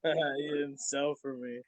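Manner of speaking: soft, slow mock-drunk mumble; drunk impression. Imitating the promoter grumbling after the punch, drunk. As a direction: imitate a drunk complaining, slowly and under the breath. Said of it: "fucked up to talk like that".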